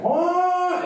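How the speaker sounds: very high, loud held honk; goose honk. Retold or imitated. Goose spreading its wings before the chase, loud goose honk. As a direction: blast a honk like an attacking goose. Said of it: "and it's like".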